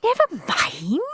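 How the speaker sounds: high, forced politeness; through gritted teeth. A narrator's reading. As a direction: forgive them through clenched teeth. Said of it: "through gritted teeth".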